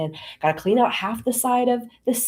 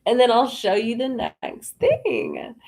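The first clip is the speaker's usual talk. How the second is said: slow, drawn-out patient instruction; voice of God; slower than usual. Retold or imitated. God sending her back to the dishes, slow patient instruction. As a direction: spell it out slowly, as God to a stalling child. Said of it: "And God said".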